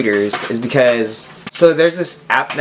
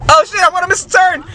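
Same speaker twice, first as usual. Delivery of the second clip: startled; 14 semitones higher, faster than usual. Real, not acted. Near miss while riding.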